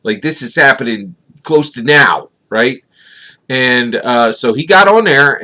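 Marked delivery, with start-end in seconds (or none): none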